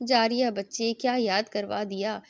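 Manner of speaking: plain reading voice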